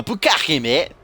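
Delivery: mock London accent. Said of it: "maybe they say it different over in, in London".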